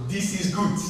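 put-on optimistic friend in an African accent. King's friend answering disaster, African accent. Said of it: "in your best African accent".

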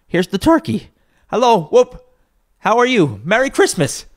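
high, loud whooping cheer; elated greeting. A narrator's reading. Scrooge greeting the turkey's arrival, overjoyed. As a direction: hail everyone in a high, jubilant voice.

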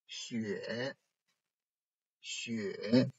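雪 in third tone, dipping then rising; tone drill. Shown as a demonstration. Teacher reading for students to circle, 雪 dipping. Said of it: "focus on the tones".

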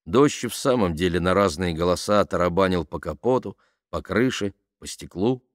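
usual speaking voice

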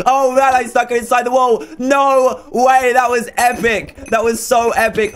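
high, excited gamer celebration; elated high-pitched outburst; thrilled amazement. Real, not acted. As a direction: exclaim it, delighted and amazed. Celebrates an unexpected kill.

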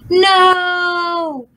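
high, loud scream; screaming demon. A narrator's reading. Demon sees her own face, screaming. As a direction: scream it in horror. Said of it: "the Asura screamed".

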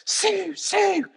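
high-pitched mock scream for someone; imitated screaming. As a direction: cry out her name high, as if in panic. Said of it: "he's screaming again".

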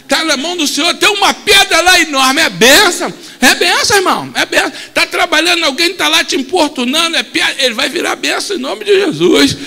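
loud, high preaching cry; full-force sermon shout; fiery preaching. Real, not acted. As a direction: preach it out with fire.

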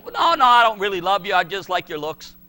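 mock fiancée. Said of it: "And she said".

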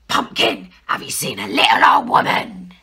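deep growling bear, loud; a big bear rumbling low. Acted. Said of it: "said the bear".

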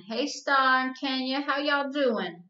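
high, slow and loud friendly greeting; the restaurant owner hailing two regulars, high and slow; cheerful old shop owner. Acted. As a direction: call out a big, drawn-out hello across the counter. Shop owner greets girls warmly.

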